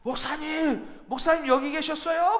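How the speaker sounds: calling out to the pastor, flushed and eager; flushed excited call. Retold or imitated. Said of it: "얼굴이 상기돼가지고". (상기된 목소리로 반갑게 부르기)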